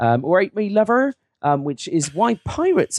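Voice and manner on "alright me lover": high rustic West Country greeting; West Country accent. Shown as a demonstration. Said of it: "the West Country".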